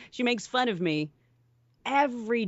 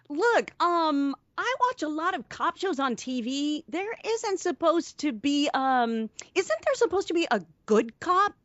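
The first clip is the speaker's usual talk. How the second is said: high-pitched hesitant pleading; nervous captive character; 9 semitones higher than usual. Acted. Reading the movie prisoner's part.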